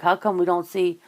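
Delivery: ordinary delivery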